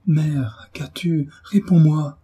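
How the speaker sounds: frantic son begging for an answer; distraught cry. A narrator's reading. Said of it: "s'écria Frédéric en s'agenouillant éperdu".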